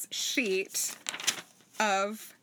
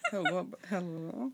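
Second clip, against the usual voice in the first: mumbling; 4 dB quieter than usual. Shown as a demonstration. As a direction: mumble it half asleep. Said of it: "How do you say hello in a mumbling voice?".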